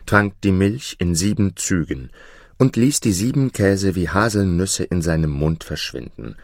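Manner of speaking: ordinary delivery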